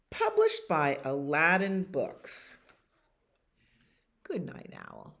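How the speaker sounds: usual speaking voice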